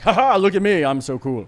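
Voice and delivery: high, loud gloating parody that settles down; hammed-up boasting; mock show-off. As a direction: imitate a braggart showing off. Mimics show-off attitude they lack.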